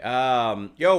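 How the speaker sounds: usual speaking voice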